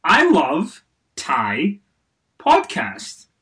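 slow, loud, deadpan word by word; reading a typo-filled tweet. Pretend. Host reading a fan's garbled tweet aloud, slow and loud. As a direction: read each word stiffly as misspelled. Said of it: "let's try one more time".

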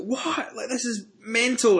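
high, disbelieving outburst, imitating himself; retold disbelief. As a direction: squeal it in shocked outrage, mocking. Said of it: "I'm getting really angry".

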